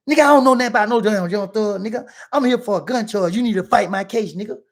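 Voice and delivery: loud retort. Retold or imitated. Client telling lawyer to fight his case, loud and indignant. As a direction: argue back loudly, indignant. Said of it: "I'm loud".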